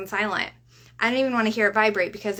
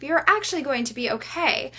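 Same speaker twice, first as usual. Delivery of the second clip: ordinary talk, nothing marked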